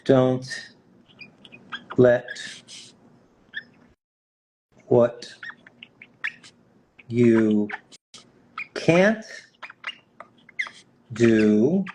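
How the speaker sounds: long pauses between single words. Real, not acted. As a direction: say each word alone, as if writing it out. Writing dictated quote word by word.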